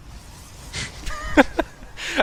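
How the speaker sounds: a high, airy laugh with no voice in it; breathy embarrassed laugh. Real, not acted. Caster laughing after botching an Irish accent, breathless high laugh. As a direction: laugh off your own bad accent attempt.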